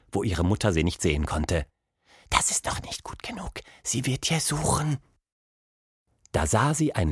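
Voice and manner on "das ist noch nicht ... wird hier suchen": soft, higher-pitched secret whisper. A narrator's reading. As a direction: whisper a warning while hiding. Hiding from mother behind tree.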